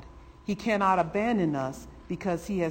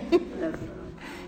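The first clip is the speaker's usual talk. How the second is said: high breathy laugh; 12 semitones higher than usual. Real, not acted. Laugh at climax.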